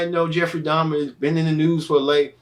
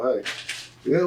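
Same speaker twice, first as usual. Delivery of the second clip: normal talking voice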